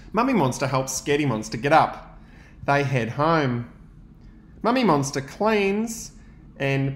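put-on lively read-aloud for young children; story narration. A narrator's reading. Teacher reading to his class, lively storytelling. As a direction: read the picture book to the class with energy.

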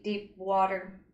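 ordinary delivery, nothing marked

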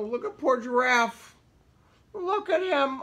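usual speaking voice

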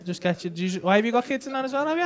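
ordinary talk, nothing marked

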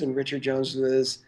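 usual speaking voice

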